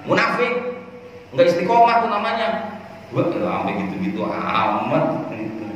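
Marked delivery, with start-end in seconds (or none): 0.1-2.8 much higher, indignant mock accusation; accusing outburst. Critic denouncing others over small worldly matters, indignant. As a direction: act out a high-pitched denunciation.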